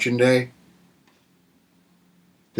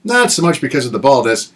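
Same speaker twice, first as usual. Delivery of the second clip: usual speaking voice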